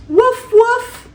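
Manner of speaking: high, yippy little puppy bark; squeaky cartoon puppy woof. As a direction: bark like a tiny playful puppy. Puppy barking in story.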